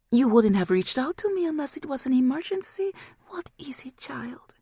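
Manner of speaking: high voice sinking to a whisper; high demon voice fading to a whisper; demon mother's voice. Acted. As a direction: end on a hushed, knowing question.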